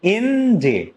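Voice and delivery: ইন ডি slowly, IN stressed and DI falling away; drawn-out ইন ডি with a weak second syllable. Shown as a demonstration. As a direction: lean on ইন, let ডি drop off lightly. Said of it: "ডি একদম আস্তে হয়ে যাচ্ছে".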